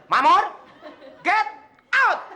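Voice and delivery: loud, high command to the driver; bossy shout. Acted. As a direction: throw the servant out, yelling. Rich boss yelling at driver. (bentakan majikan)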